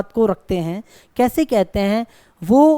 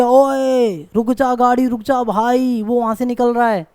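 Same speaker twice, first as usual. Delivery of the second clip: drawn-out street call to a driver; mock calling out; slower than usual. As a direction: call to a passing car, stretching the words. Acted shout to halt a car. (दूर से पुकारने का अभिनय)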